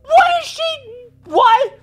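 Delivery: near-tearful shout of frustration; exasperated cry. Real, not acted. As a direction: wail it in frustrated bewilderment. Exasperated outburst at scientist.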